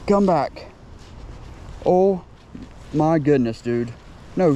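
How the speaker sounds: usual speaking voice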